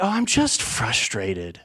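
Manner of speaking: low, slow, dragged-out excuse; acted grumbling. Brushing off a question about mood, acted low grumble. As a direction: answer glumly, drawing it out low.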